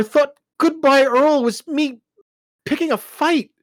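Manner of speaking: high, loud, upset; anxious explanation. Acted. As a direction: explain it, still shaken.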